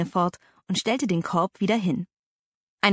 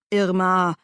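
admonishing; 3 dB louder than usual. A narrator's reading. Uncle restraining his angry wife, admonishing. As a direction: check her with just her name.

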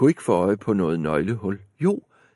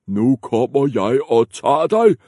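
troll threat; 4 semitones higher, 6 dB louder than usual. Acted. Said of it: "sagde trolden".

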